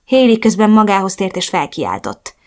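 ordinary delivery

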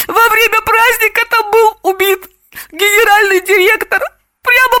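very high, tearful whine; high-pitched snivelling distress; sniffling. A narrator's reading. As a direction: whimper as if choking on tears. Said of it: "хлюпая носом, сообщила Самохина".

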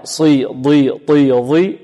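heavy covered consonants in صي and ضي. Shown as a demonstration. Teacher sums up the covered group, heavy articulation. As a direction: say صي، ضي، طي thick, tongue against palate. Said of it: "مستعلية مطبقة".

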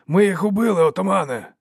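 high, loud victory cry; jubilant shout. A narrator's reading. Tul shakes the chief by the shoulder, shouting jubilantly. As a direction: cheer it out to your chief.

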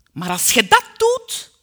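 fierce maternal threat; biting threat. A narrator's reading. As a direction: snap it as a menacing warning. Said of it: "beet ze mij toe".

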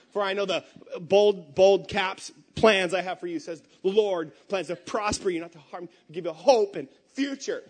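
mocking the Instagram verse read loud and bold; mock bold caps. Shown as a demonstration. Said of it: "girls post on their Instagrams with the nice font".

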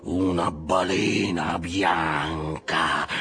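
very slow, stretched words with a strained edge; drawn-out exclamation. A narrator's reading. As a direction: dwell on each word like an obsession. Ahab repeats the cry, more held in. (esclamazione lenta e trascinata)

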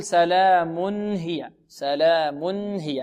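very slow "سلام هي", still n before the h; clear tanween. Shown as a demonstration. Teacher models correct recitation, clear unbounced n. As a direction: hold "سلام" long, a clean "mun" straight into "hiya". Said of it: "هكذا نطقها".

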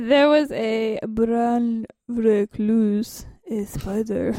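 very slow, stretched odd voice; odd drawn-out delivery. Pretend. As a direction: drag each word out strangely. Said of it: "What are you saying?".

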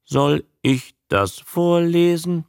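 high, evenly spaced words; monotonous talking-newt voice; monotone spelling voice. A narrator's reading. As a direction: ask word by word, laboriously. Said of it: "buchstabierte die eintönige Stimme".

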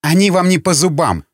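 high, mildly ferocious taunt; fierce excitement. A narrator's reading. Madeleine taunts Yakhontov about the guards, fiercely excited. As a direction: relish the threat, pitch lifted. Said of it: "скорчила свирепую гримасу и с каким-то садистским возбуждением".

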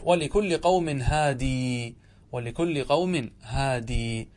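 very slow هادي with long final ee; ya kept in recitation. Shown as a demonstration. Teacher cites Ibn Kathir's reading, slow, long ee. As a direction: stretch the ya at the end of هادي. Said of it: "كقراءة ابن كثير".

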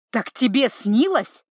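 slow, loud near-shout; almost shouting. A narrator's reading. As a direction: nearly yell it out slowly. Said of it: "почти заорал Синекрылый".

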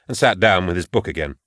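usual speaking voice

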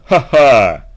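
loud, acted triumphant laugh; gleeful laugh. A narrator's reading. Warrior exults over his kill, gleeful laugh. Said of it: "радостно воскликнул воин".

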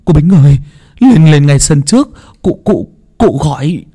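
high, loud, stammering alarm; panicked servant calling out in short repeated bursts; urgent calling. Acted. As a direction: raise the voice and stumble over the words.

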